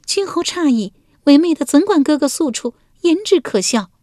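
high, flustered denial; flustered court lady. A narrator's reading. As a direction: brush it off while blushing. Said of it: "文姜不觉面赤道". (文姜面红耳赤，尖声辩解)

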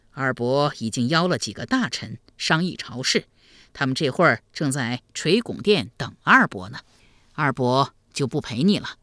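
low, even elder's voice; calm avuncular sovereign; kindly emperor uncle. Acted. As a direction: excuse yourself gently, as a ruler to his nephew.

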